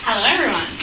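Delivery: ordinary talk, nothing marked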